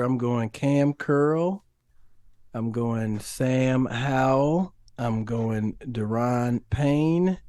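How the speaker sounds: slow drawn-out names, rising at each end; slow deliberate picks. Real, not acted. Podcast guest picking players, slow and deliberate. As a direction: take your time naming each pick. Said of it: "listing picks slowly".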